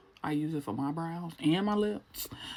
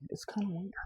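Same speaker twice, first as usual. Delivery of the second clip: hushed, softer aside; whispering; 2 semitones higher, 6 dB quieter than usual. Shown as a demonstration. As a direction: whisper it under breath. Whispered then shouted.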